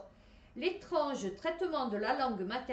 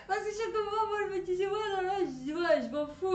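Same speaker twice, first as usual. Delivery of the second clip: laughing dismissal; 8 semitones higher, 3 dB louder than usual. Retold or imitated. Retelling her own dismissive thoughts.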